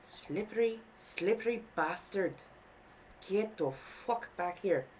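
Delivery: wandering Renaissance fair accent, annoyed and a bit high. Real, not acted. Cursing slippery sewing in an annoyed, Scottish-Irish-esque Renaissance voice. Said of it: "that's my annoyed Renaissance voice".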